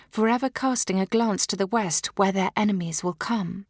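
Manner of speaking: usual speaking voice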